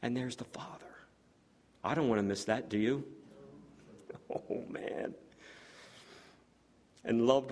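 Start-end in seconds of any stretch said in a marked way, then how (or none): 0.0-0.8 hushed whisper in wonder; awed whisper. Acted. Preacher picturing the Father before his church, awed whisper. 4.3-5.1 whispered in wonder; awed whisper. Real, not acted. Preacher overwhelmed by the vision, soft whisper. Awe after laughing.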